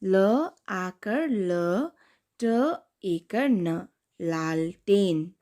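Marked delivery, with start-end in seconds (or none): 0.0-3.9 very slow letter by letter; slow spelling. Shown as a demonstration. Teacher spelling a new word for kids. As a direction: spell it out slowly. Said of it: "अब दूसरा शब्द है".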